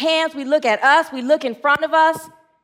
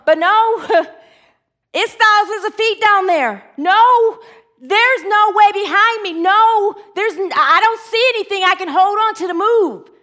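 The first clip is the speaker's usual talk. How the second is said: high, raised objections; acted panicked protest; 6 semitones higher, 4 dB louder than usual. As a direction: resist in exaggerated fear. Voices the fearful objections to God.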